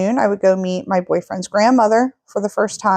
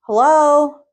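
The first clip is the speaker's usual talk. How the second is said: high, loud call of "Hello, hello" into the house; 7 semitones higher, 3 dB louder, much slower than usual. Retold or imitated. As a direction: call "Hello, hello" out loud to announce you're home. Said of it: "I screamed".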